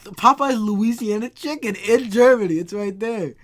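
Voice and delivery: loud, high, thrilled proclamation; excited insistence. Real, not acted. Showing a doubting friend the Popeyes, excited shout.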